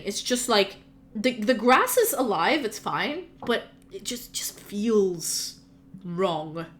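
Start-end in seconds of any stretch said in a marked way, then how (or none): 3.9-6.7 soft, near-whispered eerie narration; hushed, ominous game master voice; hushed unease. Acted. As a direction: let it sink into an uneasy hush. Game master describes an eerie scene.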